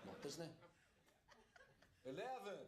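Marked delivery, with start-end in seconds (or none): 2.0-2.6 high "Eleven" in a bad American accent; a fake American that sounds like Dublin; botched American voice; mock American accent. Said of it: "Where in America is that? Dublin?".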